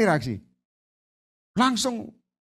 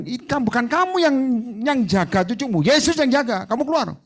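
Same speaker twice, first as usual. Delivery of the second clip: higher, louder rebuke to the spirit; stern command; 6 semitones higher, 3 dB louder than usual. Retold or imitated. Preacher rebuking the spirit, louder and higher. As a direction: order the spirit out firmly. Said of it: "tak bilang".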